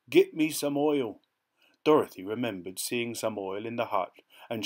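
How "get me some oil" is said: raised, louder pleading moan. A narrator's reading. Rusty Tin Man asking helpers for oil, moaning. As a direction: moan it out as a plea. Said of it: "moaned the Tin Man".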